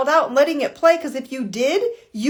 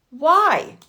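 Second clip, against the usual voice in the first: ordinary delivery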